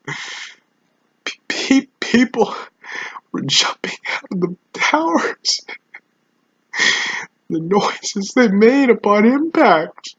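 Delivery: halting, high, choked-up voice; breaking down. Acted. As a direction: struggle to get the words out. Survivor breaks down recalling jumpers.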